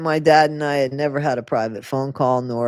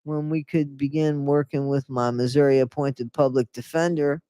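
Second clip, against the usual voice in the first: usual speaking voice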